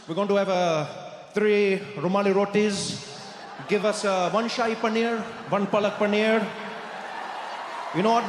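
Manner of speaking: put-on overdone Indian accent, proudly ordering; too-hyped Indian accent impression. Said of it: "that's when I Trudeau'd".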